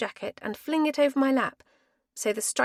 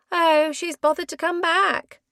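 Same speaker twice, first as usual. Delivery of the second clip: teasing; 6 semitones higher, 7 dB louder than usual. Acted. Teasing voice at fire pit.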